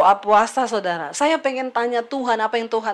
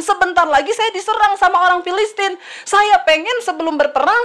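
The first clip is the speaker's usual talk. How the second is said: high-pitched, aggrieved self-defence; protesting innocence; 7 semitones higher, 3 dB louder than usual. Acted. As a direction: plead your case like a wronged king. Acts out Saul protesting innocence. (membela diri dengan nada tinggi)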